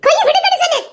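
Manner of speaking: loud, high sharp insistence; angry demand. Acted. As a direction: order him to let go, angry. Demanding he let go.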